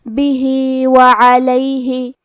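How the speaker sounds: بہی with the final vowel drawn two counts; lengthened vowel. Shown as a demonstration. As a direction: stretch the ہی of بہی for two beats. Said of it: "اپنی آواز دو مد کے برابر لمبی کریں گے". (بہی میں دو مد کے برابر آواز لمبی)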